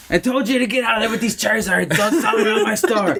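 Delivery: high, laughing mock scolding; mock angry shop owner. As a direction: shoo the dealer away in a put-on high voice. Store owner shooing dealer, acted.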